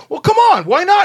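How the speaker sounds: high, loud mock pleading; imitated coaxing. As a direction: push back with a high, insistent whine of protest. Acted pleading in retold dispute.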